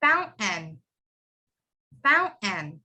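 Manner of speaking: "fountain" as foun, catch, "en"; silent T. Shown as a demonstration. Teacher has everyone repeat, silent T in fountain. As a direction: swallow the T in "fountain", end on N. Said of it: "Can everyone say that?".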